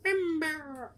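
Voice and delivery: tiny high creature squeak, drawn out; high cutesy mumbling falsetto; Gizmo. Retold or imitated. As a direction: squeak it softly in a little critter voice. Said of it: "he's like".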